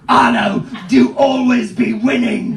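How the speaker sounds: booming baby-pirate boast, hammed up; infant rapper brag. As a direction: stand up and proclaim it grandly, drawing it out. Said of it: "like some sort of infant rapper".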